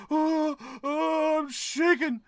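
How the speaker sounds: mocking a protester pretending to tremble; mock shaking protester. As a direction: fake being scared for the camera. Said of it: "I wanna shake for the camera".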